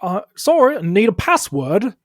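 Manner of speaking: high, loud doorkeeper. Acted. Doorkeeper refusing a visitor entry, high and loud. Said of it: "And the voice says".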